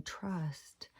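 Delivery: a kind inner voice soothing her; a hushed, tender whisper of reassurance; soft sweet inner voice. Retold or imitated. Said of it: "that nice, sweet voice came".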